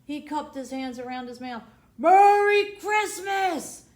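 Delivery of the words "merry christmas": loud, slow drawn-out shout. A narrator's reading. Conductor shouts from the train, loud and slow. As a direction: call it across the wind, stretched long. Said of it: "he shouted".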